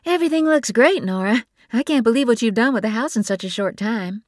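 a breezy younger sister full of praise; fast, high gushing sister voice; Bree's voice. A narrator's reading. Bree voiced by narrator.

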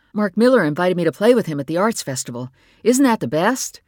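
bursting to share the news; excited son. Acted. Son telling parents about the festival, thrilled.

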